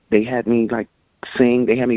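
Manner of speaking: normal talking voice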